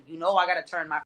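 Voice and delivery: ordinary delivery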